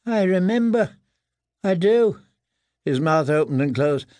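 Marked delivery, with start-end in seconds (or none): 0.1-2.2 high, pleading, cowed voice; tearful. A narrator's reading. Prisoner assuring the boys he remembers, tearful. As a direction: insist on it in a high whimper. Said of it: "Tears ran down his cheeks".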